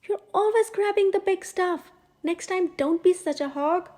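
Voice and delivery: hammed-up bickering crook; snapping burglar. A narrator's reading. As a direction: scold a greedy partner in crime. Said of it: "the other hissed".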